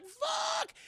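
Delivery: very high, drawn-out mock shriek; acted shriek of horror; horrified yell. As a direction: scream the swear word like a movie victim. Maitre d' reacts in horror.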